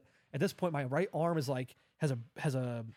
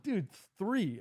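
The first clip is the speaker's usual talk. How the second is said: retold exasperation, pitched up; exasperated; 6 semitones higher than usual. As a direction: count it off in disbelief. Retold exasperation, third minefield.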